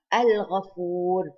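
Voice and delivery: slow Al-Ghafur with a clear L; lam pronounced. Shown as a demonstration. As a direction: draw out Al-Ghafur, sounding the lam before gh. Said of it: "we pronounce the lam".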